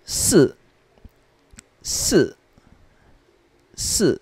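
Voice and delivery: Si falling steeply, the i barely voiced as a vowel; fourth tone. Shown as a demonstration. Teacher reading number four, Si in falling fourth tone. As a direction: hiss the S of Si, then drop from high. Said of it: "is in the fourth tone".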